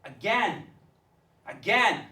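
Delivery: forceful, rhythmic spoken-word delivery; slam poetry. Acted. Poet addressing the teacher Missus Parrott, impassioned slam delivery. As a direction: perform it like a high-energy slam poem.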